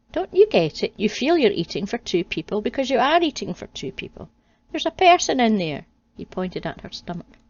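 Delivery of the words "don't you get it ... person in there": excited. Acted. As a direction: press the discovery eagerly. Adam excited realization.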